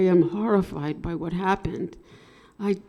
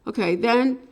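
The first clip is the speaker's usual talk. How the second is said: normal talking voice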